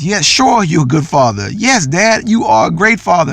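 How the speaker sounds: imagined eager praise. Retold or imitated. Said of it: "What I was looking for her to tell me was".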